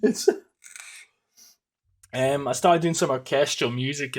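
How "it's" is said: high, louder, giggling speech; laughing through words. Real, not acted. Admitting to viewers he watched Cyberpunk instead, laughing. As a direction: laugh it out, embarrassed.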